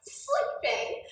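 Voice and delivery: high, loud, laughing; laughing mock relief. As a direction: blurt it through laughter. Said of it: "I'm like".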